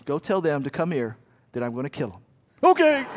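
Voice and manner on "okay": put-on high, loud whiny child voice; child's voice. Father quoting his child to the congregation in a high whiny child voice. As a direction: play the tattling kid, pitched way up.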